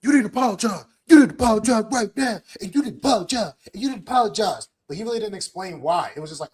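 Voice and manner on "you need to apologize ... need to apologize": higher, repetitive hyped-up nagging; mocking Jay. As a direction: parrot his demands in a pumped, higher voice. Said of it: "But he's like".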